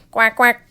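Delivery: loud quacking duck call; duck quacks. Acted. Ducky Lucky quacking in alarm at the news, loud. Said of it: "exclaimed Ducky Lucky".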